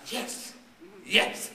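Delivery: imitating a man shouting for joy; retold shout. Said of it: "and he shouts".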